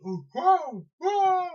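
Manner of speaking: high, loud whoas of a freaked-out hero; flipping out. Retold or imitated. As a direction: act out flipping out at your new body. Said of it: "He's, like, flipping out".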